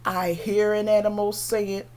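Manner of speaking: ordinary delivery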